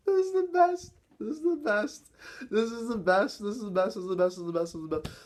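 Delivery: from a high near-sob down to calm; ecstatic cry fading down. Real, not acted. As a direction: repeat it, calming down each time. Repeated cry, winding down.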